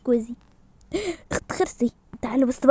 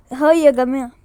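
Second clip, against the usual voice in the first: ordinary delivery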